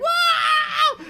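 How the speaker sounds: high, loud excited whoop; hammed-up crowd shout. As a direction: yell it out in the cinema.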